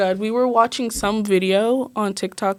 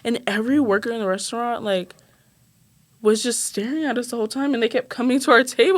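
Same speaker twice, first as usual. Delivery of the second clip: ordinary talk, nothing marked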